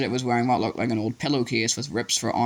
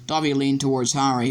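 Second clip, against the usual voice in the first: ordinary delivery, nothing marked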